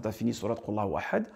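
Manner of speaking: ordinary delivery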